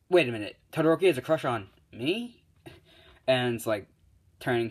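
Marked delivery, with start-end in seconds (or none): 0.1-2.4 raised voice leaping up at the end; acted surprise, higher and louder; stunned question. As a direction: ask in flustered disbelief. Izuku stunned by the crush.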